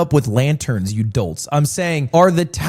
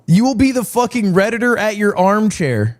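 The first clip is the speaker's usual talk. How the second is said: ordinary talk, nothing marked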